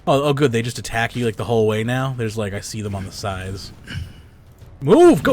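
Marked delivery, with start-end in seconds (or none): 4.8-5.3 sudden high, loud yell; shouting. Real, not acted. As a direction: bark it out, frustrated. Yelling at game enemies.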